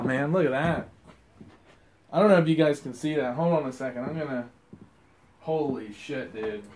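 usual speaking voice

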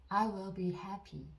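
very slow, each word spaced out for repeating; dictation-slow teacher read; slow model sentence. Shown as a demonstration. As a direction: say it slowly so learners can follow. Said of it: "듣고 따라해 보세요".

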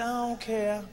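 very soft imitated wife; hushed reply. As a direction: answer quietly, just between partners. Retold wife's answer in private.

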